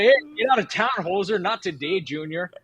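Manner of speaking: high, hammed-up Canadian trash talk; mock a teammate in a goofy Canadian accent. Gamer shows how he trolls players, in a high Canadian accent. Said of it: "talk in a Canadian accent".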